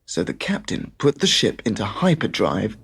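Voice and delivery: usual speaking voice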